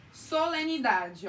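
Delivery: Solenidade drawn out syllable by syllable; slow teaching demo of the syllables. Shown as a demonstration. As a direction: stretch Solenidade so each vowel is heard. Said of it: "toda sílaba tem pelo menos uma vogal".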